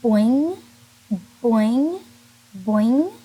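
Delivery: loud boing rising at the end; bouncy boing. Shown as a demonstration. Teacher bouncing boing for kindergartners, loud rising. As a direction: bounce each boing upward. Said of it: "Your lips kinda bounce".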